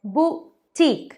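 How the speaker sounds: बुटीक drawn out slowly, stress on the end, "boo-teek"; "बुटीक" slow with a long ee; correct boutique. Shown as a demonstration. Teacher slowly models "बुटीक" for viewers. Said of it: "अब next word is".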